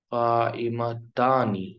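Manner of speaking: قائمتانی drawn out slowly, aani ending clear; slow teaching pronunciation; slow model word. Shown as a demonstration. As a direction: stretch قائمتانی for the class. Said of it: "آنی کی آواز دونوں میں آئے گی".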